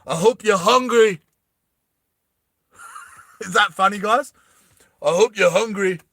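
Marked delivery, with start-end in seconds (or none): 0.1-1.2 a hint of a cocky hero quip; action hero one-liner. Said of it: "he might say another quote". 5.0-6.0 action hero one-liner. Retold or imitated. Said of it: "he might say another quote".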